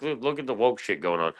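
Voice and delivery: normal talking voice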